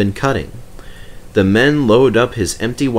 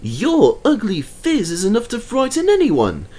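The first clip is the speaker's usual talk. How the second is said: crossly; 12 semitones higher than usual. A narrator's reading. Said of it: "said Thomas crossly".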